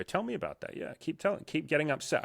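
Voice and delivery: high, soft, coaxing sales voice; coaxing fake sympathy. Salesman prods an upset client to keep talking, soft fake sympathy.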